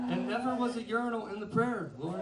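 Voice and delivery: high, laughing mock complaint; laughing protest to God. Singer tells God in laughing mock complaint. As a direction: laugh while protesting to the Lord.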